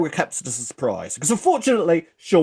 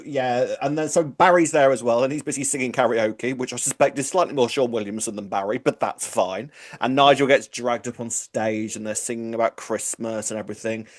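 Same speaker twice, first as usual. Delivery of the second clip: usual speaking voice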